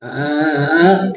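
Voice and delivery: hummed syllables climbing steadily to the end; rising Thai tune. Shown as a demonstration. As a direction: mimic Thai accent tune, going up and up. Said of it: "go up and up and up". (เลียนทำนองสำเนียงไทย ไล่เสียงขึ้น)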